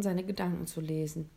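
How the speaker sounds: usual speaking voice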